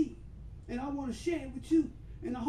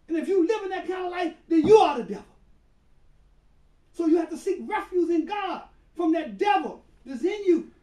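louder, rising to a shouted climax; preaching at full force; 4 semitones higher, 8 dB louder than usual. Acted. Preacher warning the congregation about the devil, building to a shout. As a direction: drive it home like a fiery preacher.

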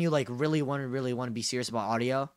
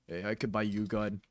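lightly low pickup-line voice; bassy e-boy voice; 7 semitones lower, 6 dB quieter than usual. Retold or imitated. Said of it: "The bassy voice are".

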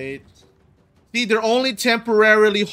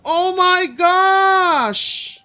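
very high, drawn-out whine of protest; exasperated wail; 11 semitones higher, 5 dB louder, slower than usual. Real, not acted. Gamer protesting to the game, in an exasperated wail. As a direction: wail it out, stretching the last word.

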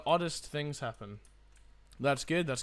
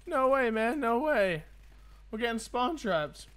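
surprised; 8 semitones higher, slower than usual. Real, not acted. Getting spawn trapped in game.

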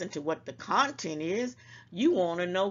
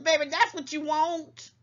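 ordinary delivery

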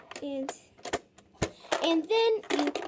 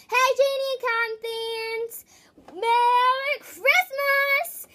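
slow, high, loud greeting; excited greeting; 5 semitones higher, 5 dB louder, much slower than usual. Acted. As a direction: stretch the hello out with glee. Channel greeting, drawn out.

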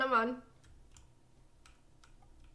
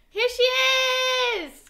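excited; 9 semitones higher, 10 dB louder, much slower than usual. Real, not acted. Bus arrives in game.

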